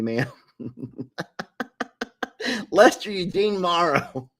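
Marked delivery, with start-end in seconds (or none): 2.7-4.3 laughing full name. Real, not acted. Mock full-name scolding, laughing.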